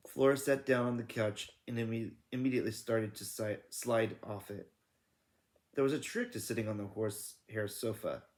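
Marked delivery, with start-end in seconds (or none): none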